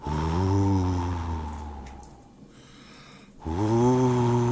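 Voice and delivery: long, eerie howling wind voice; wailing north wind. A narrator's reading. The north wind wails at the wigwam, long and eerie. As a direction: moan it out like winter wind. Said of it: "wailed the north wind".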